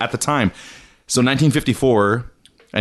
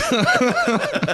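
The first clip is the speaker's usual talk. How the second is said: laughing. Real, not acted. Laughing at burping.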